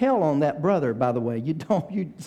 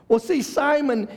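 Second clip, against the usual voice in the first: raised, fervent preaching voice; 7 semitones higher than usual. Acted. Pastor preaches to the congregation with fervor. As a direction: preach it with rising fire. Preaching about Peter's rebuke.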